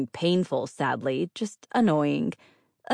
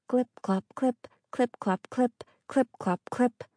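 hoofbeat sounds; 8 semitones higher than usual. A narrator's reading. Hoof steps imitated.

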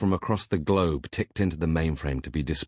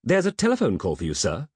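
high, quick chirp of a robotic butler; 7 semitones higher, 3 dB louder than usual. A narrator's reading. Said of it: "a metallic voice chirped over the intercom".

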